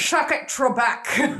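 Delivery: put-on game-show heckle in a Scottish accent; Sean Connery. Showing her friend how the actor sounds, Scottish impression. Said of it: "You know how he sounds, though".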